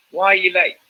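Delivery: pointed, accusing question; acting the subtext. Shown as a demonstration. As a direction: let the real meaning show through. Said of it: "You say".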